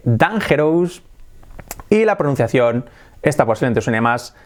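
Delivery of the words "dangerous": dangerous read Spanish-style, dan-HE-rous; como se escribe. Shown as a demonstration. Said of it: "Se escribe". (dangerous leído 'dan-jé-rous')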